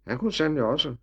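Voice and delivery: ordinary delivery, nothing marked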